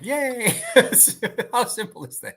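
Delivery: a hammed-up squeal of relief; mock delight. Co-host voices joy at an easy envelope, mock delighted. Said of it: "I like".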